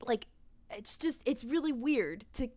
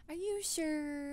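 doubtful mock quote; 7 semitones higher, 6 dB quieter, much slower than usual. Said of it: "They're like".

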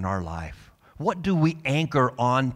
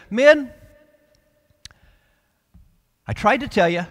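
much higher, much louder call to the crew; commanding shout; 9 semitones higher, 8 dB louder than usual. Retold or imitated. Prisoner Paul standing on deck addressing the crew, ringing shout. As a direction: rise up and call over the chaos. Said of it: "and he says".

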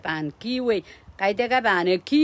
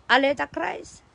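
laughing; 5 semitones higher than usual. Real, not acted. Laughs after key phrase.

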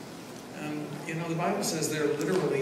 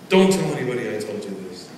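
ordinary delivery

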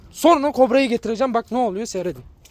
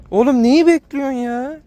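exasperated plea; 5 semitones higher, 4 dB louder than usual. Real, not acted. Exasperated at the dog.

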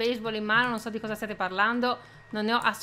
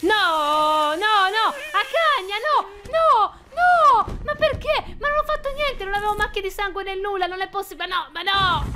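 much higher and louder wail of no; despairing outcry; 8 semitones higher, 5 dB louder than usual. Real, not acted. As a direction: cry out in disbelief at being caught. Arrested, despairing outburst. (grido disperato, molto più acuto e forte)

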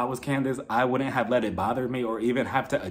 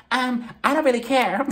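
high-pitched mock indifference; airy, higher-voiced imitation of not caring; Portia; 9 semitones higher, 4 dB louder than usual. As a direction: imitate a friend's higher, dismissive voice. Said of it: "Portia was just like".

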